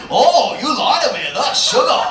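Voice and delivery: high, loud-ish customer accusation; mock scandalized customer; accusing customer.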